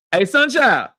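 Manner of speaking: usual speaking voice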